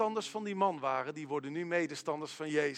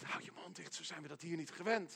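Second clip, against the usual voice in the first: much softer, hissed-down church rebuke; hushed rebuke; 10 dB quieter than usual. Acted. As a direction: hush them without raising your voice. Bystander hushes the eager believer.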